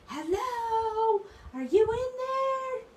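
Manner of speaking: high-pitched loud drawn-out holler; yelling. Retold or imitated. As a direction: call out like an excited kid yelling into a car. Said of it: "So he starts yelling".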